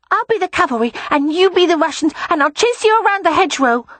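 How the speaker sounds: put-on a boy planning his battle; excited little boy. A narrator's reading. Boy plans war game.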